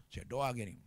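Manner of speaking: ordinary talk, nothing marked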